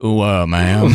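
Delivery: put-on a GI courting in a Southern accent. Joking about a GI grandfather, hammed-up Southern accent. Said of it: "a deeply Southern accent".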